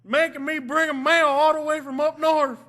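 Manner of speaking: loud, high, hollering country mailman; grumbling mailman. Acted. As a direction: holler a hammed-up complaint as the mailman. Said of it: "when the mailman was asked about the town".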